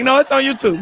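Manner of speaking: high, loud protesting imitation; mimicking Janae. Mimicking Janae's high, loud protest from the audience. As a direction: squeak out her defensive comeback. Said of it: "She's like".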